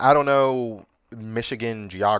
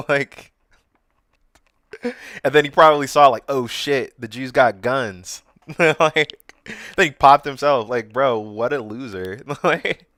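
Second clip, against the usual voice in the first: louder, higher, laughing between phrases; derisive laughing; 4 semitones higher, 7 dB louder than usual. Real, not acted. Host mocking the failed attacker, laughing through the retelling. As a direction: break into real laughs while mocking.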